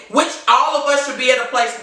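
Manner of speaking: normal talking voice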